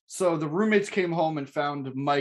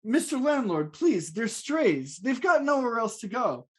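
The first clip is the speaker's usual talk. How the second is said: higher-pitched, hammed-up begging; pleading tenant; 6 semitones higher than usual. [name] begging the landlord for his cats, high pleading. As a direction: plead the case as a hapless tenant.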